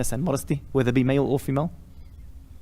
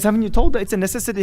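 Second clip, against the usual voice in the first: higher, quick challenge to the husband; insistent questioning; 6 semitones higher, 5 dB louder, faster than usual. Retold or imitated. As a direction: press the question, voice raised in pitch. Acted doctor questioning the husband in story.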